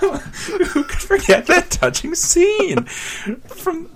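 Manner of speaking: high, slow, laughing through the words. Real, not acted. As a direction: struggle to speak through the giggles. Riffing while cracking up.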